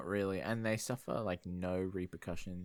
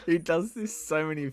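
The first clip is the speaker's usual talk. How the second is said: laughing; 9 semitones higher, 7 dB louder than usual. Real, not acted. Laughing about the character.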